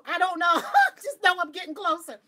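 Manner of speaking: loud, high, laughing outburst; excited exclamation. Retold or imitated. Answering what she is getting closer to, giddy shout. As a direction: cry it out, giddy and clueless.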